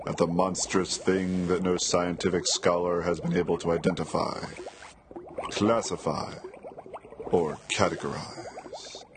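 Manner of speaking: carnival barker intro; snake oil seller. Acted. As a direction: step right up showman voice.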